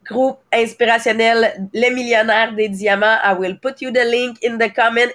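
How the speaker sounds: ordinary talk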